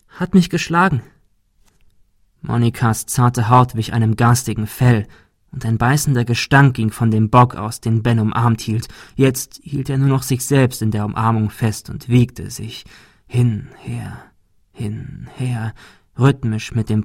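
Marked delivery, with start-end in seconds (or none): 0.1-1.0 hohe, weinerliche Stimme; weinerlich klagend. A narrator's reading. Monika gesteht. 13.3-15.7 rhythmisch geflüstert, immer leiser. A narrator's reading. Ben wiegt sich im Rhythmus.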